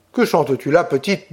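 ordinary delivery, nothing marked

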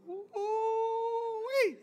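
high falsetto whoop of delight; playful high whoop; ooh-wee. Real, not acted. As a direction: squeal it like the verse got you. Reacting to romantic verse.

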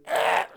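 short mock child yell; kid's scream. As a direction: imitate a kid's sudden shriek. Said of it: "kid goes".

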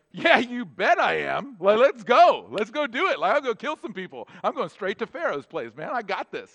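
loud, brash swagger; young Moses boasting, itching to fight; cocky bravado. Retold or imitated. Young Moses brags he'll take on Pharaoh, cocky. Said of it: "Moses would be like".

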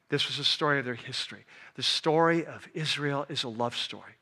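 hushed, reverent sermon voice; tender preaching. Acted. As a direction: let the love sink in quietly. Preacher turns soft and tender.

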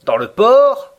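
the Canadian mocks Conseil's sea story; ironic. A narrator's reading. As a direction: poke fun at the claim. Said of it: "dit Ned Land ironiquement".

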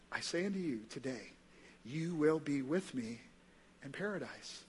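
hushed, unhurried assurance; tender solemn promise. Retold or imitated. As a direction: speak the promise very softly, with measured pauses. Jesus answers the dying criminal.

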